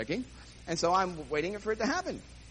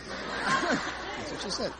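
slow, laughing through the words; can barely get it out for laughing; slow, amused, chuckling; 9 semitones higher, much slower than usual. Real, not acted. Laughing at absurd warning.

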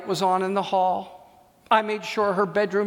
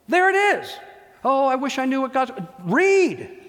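excited exclamation; 5 semitones higher than usual. Retold or imitated. Exclaiming to student.

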